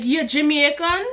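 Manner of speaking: playful put-on Jamaican accent; imitated Jamaican-accented question. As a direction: ask it in mock Jamaican. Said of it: "I be playing with people's".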